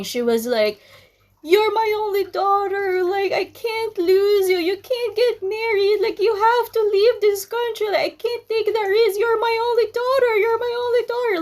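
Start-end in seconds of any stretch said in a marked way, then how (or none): 1.4-11.4 mother's voice pushed very high, tearful and pleading; hysterical crying mother. Retold or imitated. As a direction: imitate a sobbing, panicked mother begging her daughter. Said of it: "my mother was hysterical. She was crying".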